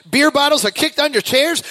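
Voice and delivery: ordinary talk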